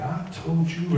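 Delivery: "told you" said syllable by syllable in imitation. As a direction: mimic dad hitting each syllable of "I told you". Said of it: "he's syllable spanked".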